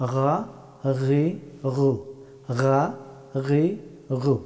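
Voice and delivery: "গা, গি, গু" as throaty gha, ghi, ghu; Arabic ghayn. Shown as a demonstration. Teacher demonstrating ghayn, upper-throat friction. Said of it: "গলার উপরের অংশ থেকে".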